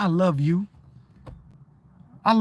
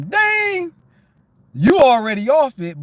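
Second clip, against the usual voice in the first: high startled exclaim, louder; delayed realization; 6 semitones higher, 5 dB louder, slower than usual. Retold or imitated. Finally getting what someone said days ago, a surprised cry. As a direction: snap awake, days late.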